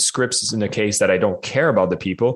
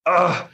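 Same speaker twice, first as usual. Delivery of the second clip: dismayed groan; 9 semitones higher than usual. Retold or imitated. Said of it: "And I'm like".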